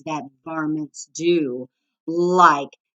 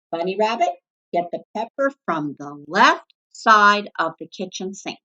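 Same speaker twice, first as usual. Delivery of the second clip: ordinary delivery, nothing marked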